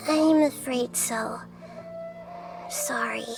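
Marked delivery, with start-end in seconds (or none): 2.7-3.2 low, soft, sorry murmur; quiet, subdued regret; regretful apology. Acted. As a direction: apologise gently, voice dropped. Apology for bad news.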